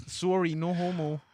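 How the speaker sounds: lightly hammed Canadian accent; mock Canadian. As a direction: parody an apologetic Canadian, giggling into it. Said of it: "What do you think Canadians are gonna think".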